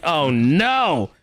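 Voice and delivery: retold dismay. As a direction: act out a parent's dismayed reaction. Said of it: "And I'm like".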